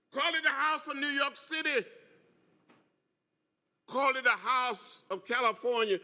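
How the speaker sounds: rhythmic repeated preacher call; preaching refrain. Real, not acted. Congressman rallying the teachers with a rousing refrain. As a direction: hammer each "Call it" like a sermon.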